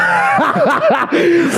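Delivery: high shrieking laugh; burst of laughter. Real, not acted. Laughing at her own bad French accent.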